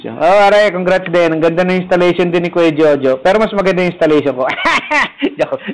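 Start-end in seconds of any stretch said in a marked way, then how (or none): 4.5-5.3 high burst of laughter; real, high cackling laugh; joking laugh. Real, not acted. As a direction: laugh at your own boast. Laughs at own boastful joke.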